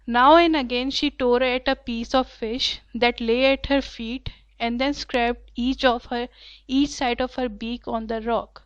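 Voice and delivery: reading the passage very slowly and clearly; slow story reading. A narrator's reading. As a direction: read each word out slowly for the class. Teacher reads English passage aloud. (Class کے لیے بہت آہستہ پڑھنا)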